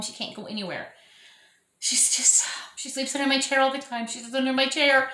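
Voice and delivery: usual speaking voice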